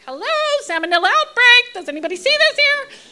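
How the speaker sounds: very high, very loud mock outrage; hammed-up disgusted cry; sarcastic alarm. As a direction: shriek it like you can't believe nobody sees it. Appalled at the wet market.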